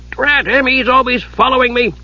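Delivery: put-on high-pitched peevish old man; cranky old wizard. A narrator's reading. Old wizard complains of being followed, cranky. Wizard grumbles about himself.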